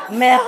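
MER broken off on a long gargled R; throaty R demo. Shown as a demonstration. Correcting the learner, throaty R. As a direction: exaggerate the throat R ending "mer-". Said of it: "with the R".

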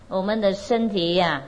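ordinary delivery

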